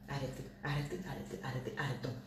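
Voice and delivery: very soft, low repeated muttering; muttered plea to stop. Real, not acted. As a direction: let it sink away under your breath. Tells commenters to stop.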